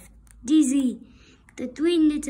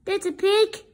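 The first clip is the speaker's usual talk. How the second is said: higher calling wolf voice; 6 semitones higher, 4 dB louder than usual. Acted. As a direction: call out to lure the pig. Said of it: "he called".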